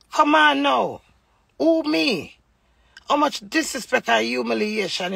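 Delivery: high, loud incredulous cry; mock his innocence loudly; indignant outburst. Real, not acted. Mocking husband's denial.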